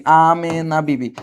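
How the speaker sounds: Amena Bibi drawn out slowly, syllable by syllable; Amena Bibi at half speed; slow repeat. Shown as a demonstration. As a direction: stretch each vowel of Amena Bibi for the class.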